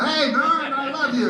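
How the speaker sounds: high, slow, adoring dog voice; happy dog voice. Retold or imitated. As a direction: gush like a dog greeting its owner. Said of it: "the fucking dog always like".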